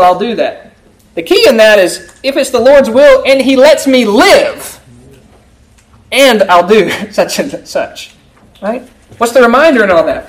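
ordinary delivery

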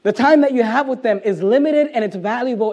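normal talking voice

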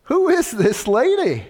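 lightly acted disbelief, voice lifted high; mock bewilderment. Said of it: "I'm thinking, ... This is strange".

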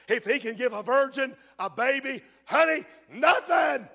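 loud, driving sermon cadence; preaching at full force. Acted. Preacher urging the church to believe, loud and driving. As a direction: preach it to the back row.

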